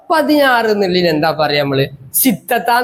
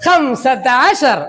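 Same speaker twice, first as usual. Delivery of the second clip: slow model; 2 semitones higher, much slower than usual. Shown as a demonstration. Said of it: "പറ".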